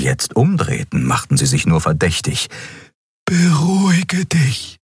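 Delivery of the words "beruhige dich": high, slow, soothing alien voice; calm him down gently, voiced high; Xea's soothing voice. A narrator's reading. Xea calms angry Kero.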